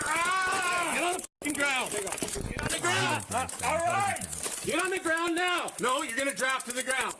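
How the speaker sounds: high, urgent yelled commands; shouted surrender demands. Real, not acted. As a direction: bark the orders to get down. Officer tasing a resisting suspect.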